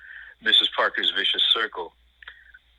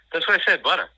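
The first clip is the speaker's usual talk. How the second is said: high, louder indignant protest; indignant kid; 9 semitones higher, 5 dB louder than usual. Retold or imitated. Teen insisting to his foster family, indignant. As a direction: insist you said it right, a little huffy. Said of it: "And I said".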